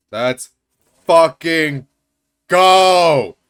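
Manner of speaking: building from plain to a loud yell; hype cry. Real, not acted. Streamer cheering the risky jump, building hype cry. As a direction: roar it out in triumph.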